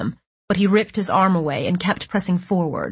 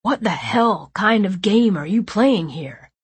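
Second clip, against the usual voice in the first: angry; 2 semitones higher than usual. A narrator's reading. Angry brother confronting.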